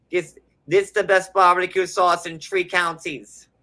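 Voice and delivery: put-on second try at a Trinidadian accent. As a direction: push the Trinidadian accent harder. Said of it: "but I'm trying to channel it".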